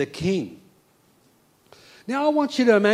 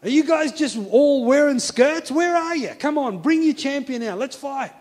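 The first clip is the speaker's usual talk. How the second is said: high, loud taunt to an enemy army; goading battlefield challenge; bellowed challenge; 8 semitones higher, 3 dB louder than usual. Acted. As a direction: call them out to fight, pitched well up. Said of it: "throwing out the challenge".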